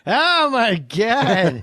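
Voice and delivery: Norm Macdonald. Acted. Comic doing his impression onstage, stretched exclamation. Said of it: "every time I do Norm Macdonald".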